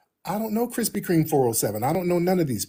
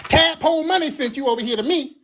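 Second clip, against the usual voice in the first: high whiny impression; put-on indignant voice, way up high; mock sassy whiner; 11 semitones higher than usual. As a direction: mimic a self-important sassy accuser. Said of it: "sassy ass dudes up in these spaces".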